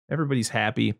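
usual speaking voice

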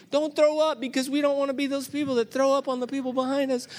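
high, loud anxious inner plea; mock panic on the coaster; panicked thinking. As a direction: fret out loud, hamming it up. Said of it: "I'm thinking".

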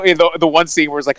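ordinary talk, nothing marked

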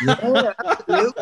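high-pitched, laughing agreement; amused agreement. Real, not acted. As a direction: play along through a laugh, pitch up high. Joking about taking all the credit.

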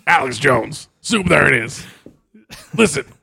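gruff radio host; strained talk-radio growl; Alex Jones voice. Pretend. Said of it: "it hurts to do that voice".

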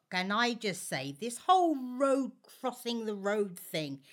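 ordinary talk

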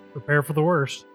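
ordinary talk, nothing marked

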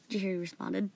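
ordinary delivery

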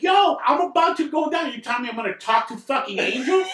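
very high, incredulous outburst; hammed-up high-pitched alarm; retold panicked protest. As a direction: act out disbelief at terrible reassurance. Retells panicked protest to orderly.

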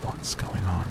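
soft, barely voiced question; mouthed. A narrator's reading. Grant silently asking Ellie during the call, mouthed softly. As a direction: mouth it so the phone can't hear. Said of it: "Grant looked at Ellie and mouthed".

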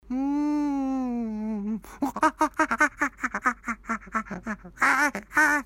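long high chimp cackle; monkey laughing at the disguise; chimp hooting. Acted. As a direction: hoot and shriek like a chimp. Chimp reacts to the clothes.

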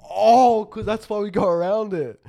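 high, loud oh of realization; the penny drops; sudden realization. Real, not acted. Sudden realization about the sun.

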